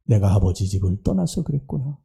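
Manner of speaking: quiet realization. Acted. Prodigal son realizing why he fell, softly. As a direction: let it dawn on him softly.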